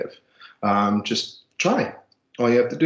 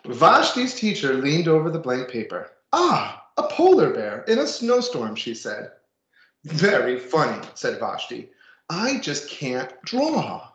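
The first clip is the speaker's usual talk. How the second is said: put-on reading to children; 4 semitones higher than usual. Reads picture book to class.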